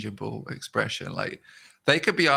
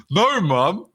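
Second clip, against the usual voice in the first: put-on higher, louder kid reply; lightly voiced child; 7 semitones higher, 6 dB louder than usual. Answering mum about the bed, mild kid protest.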